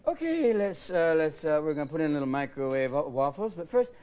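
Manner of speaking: high, strained fake cheer; lightly voiced weary dad. Tired dad placating his kids at dawn, forced cheer. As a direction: sweeten it over the exhaustion. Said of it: "you get up and go".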